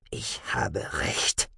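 low, soft and slow; hushed inner thought. A narrator's reading. Marv to himself with closed eyes, hushed. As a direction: say it slowly under the breath.

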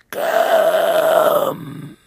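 high, very slow, breathy ghostly hiss; icy hateful voice. Acted. An unseen monster calls to Harry, slow, high and hateful. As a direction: drag each word out like a hungry monster. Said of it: "erfüllt von eiskaltem Hass".

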